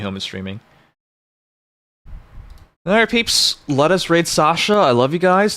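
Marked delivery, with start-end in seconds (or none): 2.9-5.6 higher, upbeat send-off; bright cheerful goodbye. Real, not acted. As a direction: lift the voice for the farewell.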